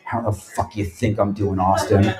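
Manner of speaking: low, muttered mock grouch; grumpy Trebek. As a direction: growl it like a worn-out host. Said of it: "He goes".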